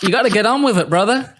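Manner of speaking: put-on high, no-nonsense Southern voice; Booty. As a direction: imitate an upbeat Southerner giving a pep talk. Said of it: "He's like".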